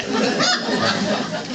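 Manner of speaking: a sudden high, airy burst of laughter; high breathy laugh. Real, not acted. Psychic telling a caller about himself, bursting into a high laugh. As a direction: laugh at yourself, breathless and amused. Laughs at his own weirdness.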